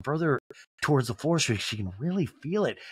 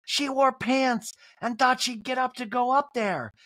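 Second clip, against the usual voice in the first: high, slow incredulous voice; put-on gossipy outrage, pitched way up; Kyle; 10 semitones higher, slower than usual. Kyle filling Crystal in, high mock disbelief. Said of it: "And Kyle's like".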